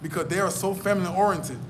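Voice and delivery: ordinary delivery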